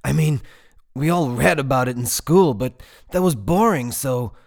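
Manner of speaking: high, loud eager rambling; carried away with excitement; enthusiastic. A narrator's reading. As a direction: babble excitedly, then tail off at the end. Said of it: "with as much enthusiasm as Roz had ever seen".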